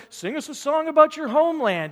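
high, loud jeering voice; mocking taunt. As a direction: sneer at them to sing. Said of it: "They're ridiculing you".

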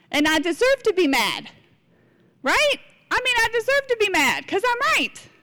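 high-pitched, playful insistence; self-righteous indignation. Acted. Preacher acting out why she hangs on to anger, self-righteous protest. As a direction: protest like someone sure they are owed their anger.

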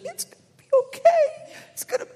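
high, teary mock-sobbing mother; crying mom. Mom comforting her son over a dropped banana, mock weeping. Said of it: "she's like".

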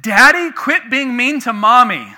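put-on high, loud little girl pleading; child's plea. Preacher voicing his little daughter pleading with him. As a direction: voice a small daughter begging dad to stop.

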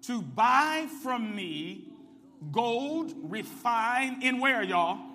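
loud, rising, call-and-response preaching; preacher calling the congregation to answer, loud; full-force preaching. Acted. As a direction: drive the scripture home to the church.

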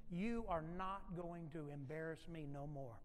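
slow, measured mock reprimand; slow, softened scolding of a child; stern mom. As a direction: lay down the law word by word. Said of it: "This is what Mom says".

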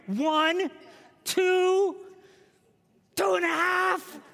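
high, loud drawn-out count; mock counting shout. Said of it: "Counting to three".

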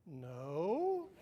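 loud innocent no; toddler's answer. Retold or imitated. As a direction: blurt it out like a proud two-year-old.

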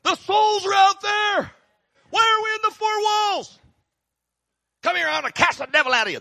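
high, strained preacher voice, shouting about souls; fiery evangelist. Retold or imitated. Preacher imitating an evangelist scolding the church, high shouting voice. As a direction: bellow it like a wild street evangelist. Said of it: "The evangelist is in church saying".

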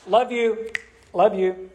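quick, careless throwaway love you; flippant. Retold or imitated. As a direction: toss it off without meaning it. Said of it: "We're a little flippant with our love".

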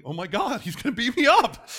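hammed-up alarm, rising high and loud; mock panic. Preacher retelling being followed by the manager, mock panic. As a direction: act scared for laughs, pitch climbing.

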